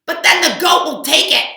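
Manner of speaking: loud high alarmed cry; loud high fretful outburst; worried cow. Acted. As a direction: panic about the goat. Cow panics again.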